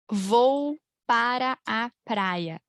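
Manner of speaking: every syllable of "Vou para a praia" drawn out slowly; slow full form. Shown as a demonstration. Teacher showing the stiff full form, slowly. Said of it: "Alguém fala isso".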